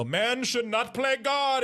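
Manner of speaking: high, slow, drawn-out proclamation; hammed-up heavy-handed moral; mock portentous. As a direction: intone it like a grand warning. Mocks heavy-handed movie message.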